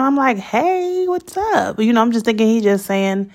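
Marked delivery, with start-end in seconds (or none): 0.4-1.8 high-pitched, pleased hello; happy greeting. Retold or imitated. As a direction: greet him happily, pitched well up. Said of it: "happy to see him".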